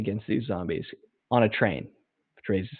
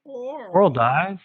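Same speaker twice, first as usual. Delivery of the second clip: high, drawn-out fake gasp of disbelief; mock shock; 10 semitones higher, much slower than usual. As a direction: ham up the surprise at the spoiler, stretched slow. Feigning shock at spoiler.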